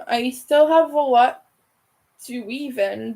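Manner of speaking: ordinary talk, nothing marked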